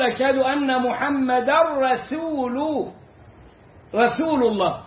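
slow أشهد أن محمدا رسول with a thin, light س in رسول; careful, measured رسول الله; slow correct recitation. Shown as a demonstration. Teacher modelling the correct Shahada. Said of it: "فهنا بتقول".

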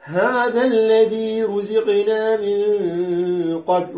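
slow drawn-out tajweed chant; Quran recitation. Shown as a demonstration. Teacher reciting the verse to the class, slow and chanted. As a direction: recite it slowly, stretching the vowels. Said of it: "كما قال الجزري".

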